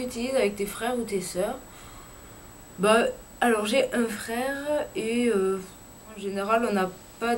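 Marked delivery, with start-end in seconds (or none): none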